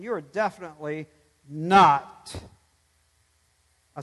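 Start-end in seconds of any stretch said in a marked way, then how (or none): 1.5-2.3 "not" punched much louder; stress on not. Real, not acted. Pastor reassuring the doubting listener, loud "not". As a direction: hit "not" hard.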